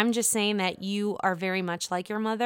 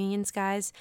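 ordinary talk, nothing marked

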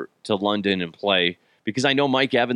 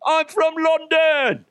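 put-on very high, slow, overblown London accent; attempted London accent; 4 dB louder, slower than usual. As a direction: belt out a badly faked London accent, drawn out. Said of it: "nail that damn accent".

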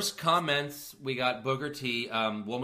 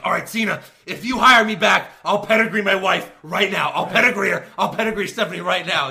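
louder, higher hammed-up offer; loud mock Triple H; 5 semitones higher, 11 dB louder than usual. Host voicing Triple H shouting his offer to Cena. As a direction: bellow the offer like a wrestling promo. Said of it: "He's like".

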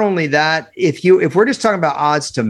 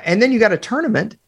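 voice cracking; 6 semitones higher than usual. Real, not acted. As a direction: voice cracks up high. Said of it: "my voice cracked there".

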